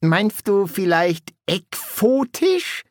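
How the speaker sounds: odd-sounding henchman's slow question; Igor. A narrator's reading. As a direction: ask slowly, rising high on the last word. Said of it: "fragte Igor".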